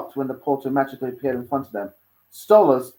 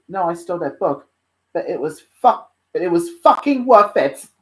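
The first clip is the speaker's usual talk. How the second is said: raised, lively character voice; bold Luna; 8 semitones higher, 6 dB louder than usual. Acted. Luna parting from Dante before sneaking back inside, bold. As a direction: keep it high, swelling at the swearing.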